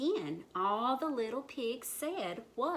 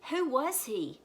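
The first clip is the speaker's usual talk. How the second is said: higher, emphatic question; puzzled outcry; 6 semitones higher, 5 dB louder than usual. A narrator's reading. Animals wonder about vanished pig, higher puzzled cry. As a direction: cry it out, baffled. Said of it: "the animals cried".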